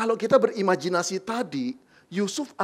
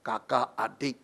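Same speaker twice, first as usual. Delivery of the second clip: mild anger; 7 semitones lower than usual. Acted. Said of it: "papanya mulai naik darah".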